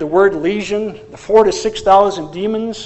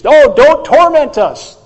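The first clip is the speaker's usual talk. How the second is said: loud, high, drawn-out plea; acted cry for mercy; begging; 9 semitones higher, 12 dB louder, slower than usual. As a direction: beg loudly as a frightened demon. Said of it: "The demons begged Jesus".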